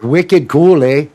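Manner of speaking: attempted Boston accent. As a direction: try Boston and land near Toronto. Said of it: "I'm trying to do Boston".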